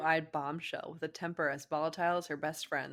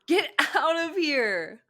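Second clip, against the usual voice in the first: loud slow shout; 10 semitones higher, 10 dB louder, much slower than usual. Acted. Shouting at boyfriend.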